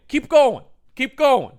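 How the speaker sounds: high, loud eerie command; loud creepy urging. Retold or imitated. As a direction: call him down the hall. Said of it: "Down at the end".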